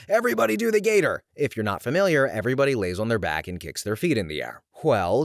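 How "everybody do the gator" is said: high-pitched, quick yelled call; band singer's yell. Retold or imitated. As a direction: call it out like a wedding singer rallying the dance floor. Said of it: "and yell".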